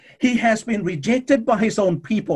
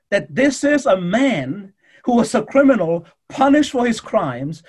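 normal talking voice

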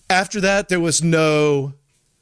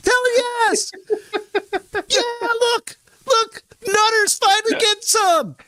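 much higher yelling bro voice; high, shouted frat-buddy cheering; cheering buddies. Retold or imitated. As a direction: hype him up like rowdy friends. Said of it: "they're like".

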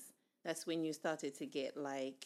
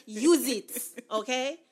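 loud, high, bossy Nigerian auntie; 7 semitones higher, 13 dB louder, much slower than usual. Acted. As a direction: order it like a strict Nigerian auntie. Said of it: "In my best Nigerian auntie voice".

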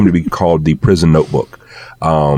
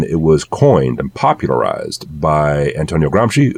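ordinary talk

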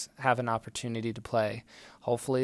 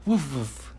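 dog woof. Shown as a demonstration. Parent models dog sound.

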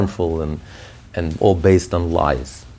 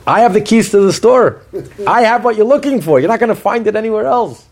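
high, insistent voice of the soul; emphatic, raised-pitch appeal from the one in charge; godly soul speaking up; 9 semitones higher, 4 dB louder than usual. Acted. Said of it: "And the godly soul says".

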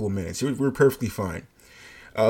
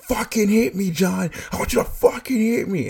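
much higher mock-furious taunt; imitated screaming dare; 10 semitones higher than usual. Coworker screaming in John's face to provoke. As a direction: dare him to hit, screamed. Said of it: "she's yelling in my face".

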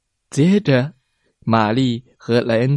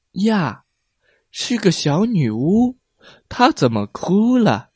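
put-on high surprised child voice; 6 semitones higher than usual. A narrator's reading. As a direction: sound amazed to find a crying witch. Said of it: "一个吃惊的声音". (孩子吃惊地问)

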